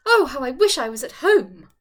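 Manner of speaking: loud, high cry of distress; distraught. A narrator's reading. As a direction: wail the wish, close to tears. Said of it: "she could restrain herself no longer".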